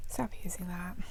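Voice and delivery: whispering. Real, not acted. Hushed aside.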